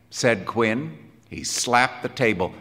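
ordinary delivery

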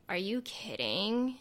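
put-on slow, drawn-out Valley girl disgust; tipsy Valley girl, exaggerated; Valley girl accent. Shown as a demonstration. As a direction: stretch it out like a lazy Valley girl. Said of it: "my Valley Girl accent comes out".

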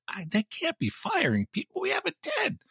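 high, panicky disbelief, voice jumping way up; incredulous high-pitched protest. Retold or imitated. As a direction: squeak it out like you can't believe it. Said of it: "And I'm like".

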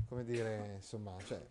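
ordinary delivery

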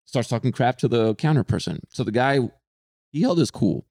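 ordinary talk

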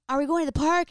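high, loud, quick shout across the distance; retold scream to a brother. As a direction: yell the question up the hill. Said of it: "I scream at him from, like, where I am".

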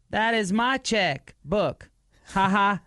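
high, slow, stilted line reading; overacted wooden delivery; bad acting. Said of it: "Worst acting ever".

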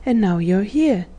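usual speaking voice

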